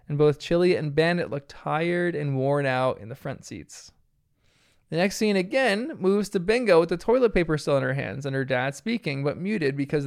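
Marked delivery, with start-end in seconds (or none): none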